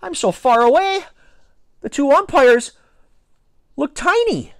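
very high, halting panicked squeak; panicking. A narrator's reading. Boy on the mound thinking to himself, panicking. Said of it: "I began to panic".

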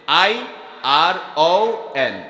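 I R O N spelled out letter by letter, slowly; spelling out. Shown as a demonstration. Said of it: "উচ্চারণ কি হবে". (ধীরে ধীরে বানান করে বলা)

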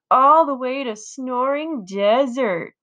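slow mocking sing-song taunt. A narrator's reading. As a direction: drag it out to tease. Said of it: "Frankie taunts".

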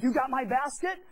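loud demanding retold question; intense demand. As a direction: accuse them of taking it. Pam confronting neighbors, intense.

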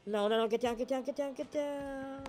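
ordinary delivery

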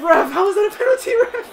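high, loud protest to the ref; outraged protest. Real, not acted. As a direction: shout your outrage at the call. Protesting penalty call.

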